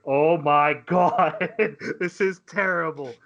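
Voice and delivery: loud, very drawn-out comic dismay; mock horror. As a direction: wail it slowly in hammed-up horror. Retold horror in dentist's chair.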